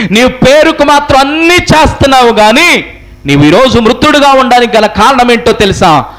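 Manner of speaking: high, sustained sermon cry; preaching at full force. Real, not acted. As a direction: declaim it to the congregation in long high-pitched phrases. (గట్టి స్వరంతో ప్రసంగం)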